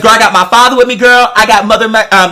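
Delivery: ordinary delivery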